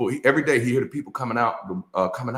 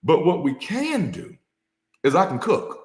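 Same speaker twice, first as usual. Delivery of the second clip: wife gently reasoning; 8 semitones higher than usual. Retold or imitated. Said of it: "She said".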